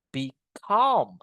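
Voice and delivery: "Become" split, with a drawn-out falling "come"; stress on second syllable. Shown as a demonstration. Teacher modelling verb stress, long stressed "come" in "Become". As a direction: land firmly on "come" in "Become". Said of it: "Ada penekanannya kan?".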